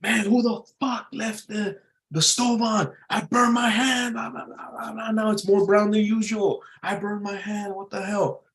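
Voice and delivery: high-pitched cursing over a burned hand; mock cussing out. Pretend cussing out after burning a hand on the stove, high-pitched. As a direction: squeal it like you just burned your hand. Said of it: "cussing out".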